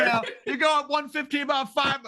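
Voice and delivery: fast, high pitched crowd directions; high, quick hollered directions; mock shouted directions. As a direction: keep yelling the route. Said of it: "people shouting directions at her".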